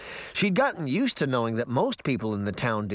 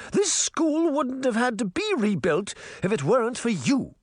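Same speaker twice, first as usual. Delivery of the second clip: high, imperious queen voice; haughty Queen of Hearts; 11 semitones higher than usual. Acted. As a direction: look down on the whole crowd. Queen accuses the mayor.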